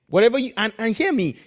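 ordinary talk, nothing marked

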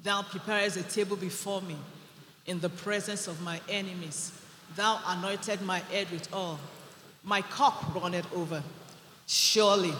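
quiet, low reverent reading of the psalm; soft scripture recitation. Acted. Pastor reciting the psalm softly to the congregation.

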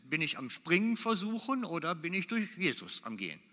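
ordinary talk, nothing marked

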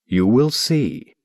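slow, distant and otherworldly; as if from far away, drawn out; remote voice. Acted. Mysterious stranger answering from the haze, slow and distant. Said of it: "as if remotely".

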